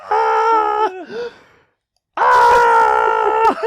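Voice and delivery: loud falling scream. Retold or imitated. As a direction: yell like falling off a cliff. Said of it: "You'd be like".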